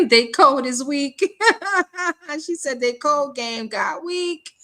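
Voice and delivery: high-pitched, laughing through the words. Real, not acted. Host reads a viewer's joke to the audience, laughing. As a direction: crack up while reading it out.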